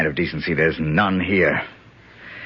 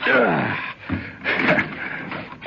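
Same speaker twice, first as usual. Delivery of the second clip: effortful grunt. Acted. Climbing onto the table.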